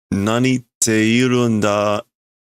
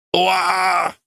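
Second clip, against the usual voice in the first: mock cry; 10 semitones higher than usual. Character crashes in game.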